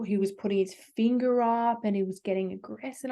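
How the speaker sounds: usual speaking voice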